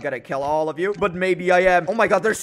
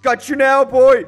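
higher, louder gloating taunt at a fish; gloating shout; 8 semitones higher, 10 dB louder, slower than usual. Real, not acted. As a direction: crow it like you just caught him.

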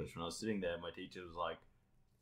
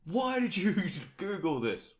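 mock accusing; 11 semitones higher, 6 dB louder, slower than usual.

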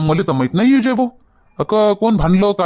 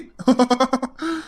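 high, mocking laugh at her; teasing laugh; 7 semitones higher, 4 dB quieter than usual. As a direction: laugh at her tears to tease her. Husband teases his crying wife.

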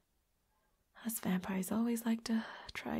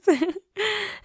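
high, loud giggle; teasing giggle; 11 semitones higher, 5 dB louder than usual. Acted. Teasing roommate about liking vampires, teasing giggle. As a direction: burst into a pitched-up giggle.

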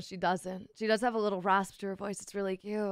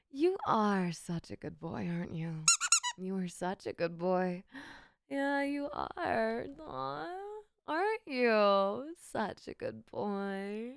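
cooing to a pet; 3 dB quieter, slower than usual. Real, not acted. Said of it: "Show us the eighteen plus version".

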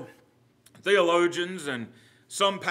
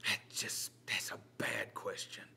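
whispered aside; 11 semitones lower, 19 dB quieter than usual. Real, not acted. Muttered aside about the question.

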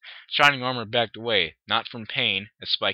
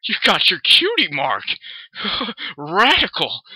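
loud, stoked surfer cheer; happy surfer; 7 semitones higher, 8 dB louder than usual. Acted. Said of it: "he repeated happily".

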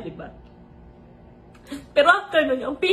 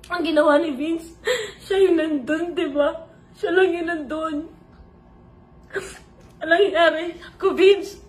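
crying; 3 semitones higher than usual. Real, not acted. Tearful retelling of the assault.

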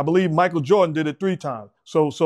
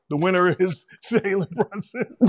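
laughing announcement; 7 semitones higher than usual. Real, not acted. Laughing while announcing an award.

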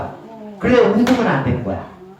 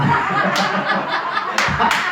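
breathless laugh; 11 semitones higher, 5 dB quieter than usual. Real, not acted. Player cracks up mid-game.

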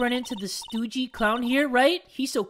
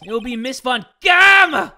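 loud, proud proclamation; shouting; 3 semitones higher, 12 dB louder than usual. Acted. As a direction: blurt the name out loud. Loud announcement.

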